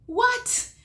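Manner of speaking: loud, very high shocked yelp. Retold or imitated. Looking up to find no storm, shocked. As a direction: burst out high and loud in disbelief. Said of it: "I was so shocked".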